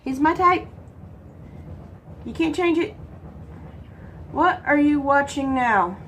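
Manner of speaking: ordinary talk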